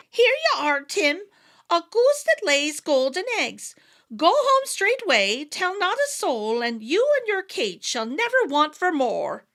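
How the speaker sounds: high fairy voice giving the goose; wee folk voice. A narrator's reading. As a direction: sing out the magic promise in a tiny voice. Wee folk hand over goose.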